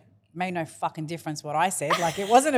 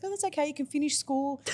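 mock sweet mum; 7 semitones higher, 7 dB quieter than usual. Mimicking mum's sweet reply.

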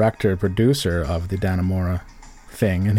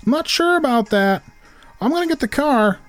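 much higher North Country voice, every line lifting like a question; high North Country accent. Retold or imitated. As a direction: play a North Country local whose every sentence floats up. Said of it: "voices get a little higher".

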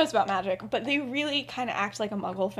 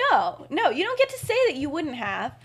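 higher, insistent protest; indignant rebuttal; 6 semitones higher than usual. Acted. Remus rejecting his mother's claim, indignant. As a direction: cut them off, refusing to hear it.

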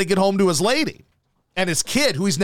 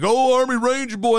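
mock taunting; 8 semitones higher than usual. Bar dudes heckling the returning soldier, mock taunting. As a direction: taunt him in a raised, needling voice. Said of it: "They're like".

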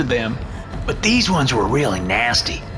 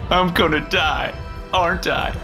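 tearful; 12 semitones higher than usual. Acted. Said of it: "tears filling his eyes".

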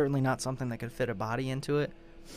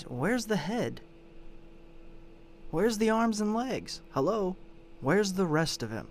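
higher-pitched, mock-baffled demands; incredulous questioning; 6 semitones higher than usual. Podcaster voicing an officer's second question about the torso, incredulous. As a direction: press each question as if missing the obvious.

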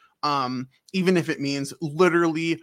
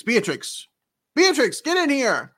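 mock yell for Beatrix; 9 semitones higher, 4 dB louder than usual. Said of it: "And she's like".

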